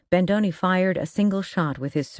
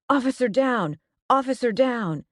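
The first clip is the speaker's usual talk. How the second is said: higher, urgent alarm call; urgent radio call; 8 semitones higher than usual. A narrator's reading. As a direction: shout it over the radio in panic. Radio call during shootout.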